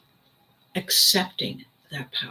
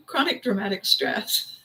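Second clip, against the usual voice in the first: diva voice; 3 semitones higher than usual. Retold or imitated. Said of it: "hanging around with divas".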